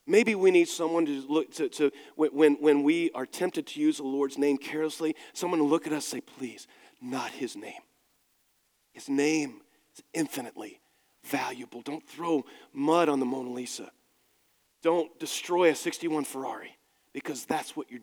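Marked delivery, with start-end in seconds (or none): 6.4-11.8 gentle plea. Pretend.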